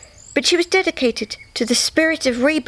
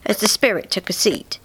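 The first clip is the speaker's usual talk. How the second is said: usual speaking voice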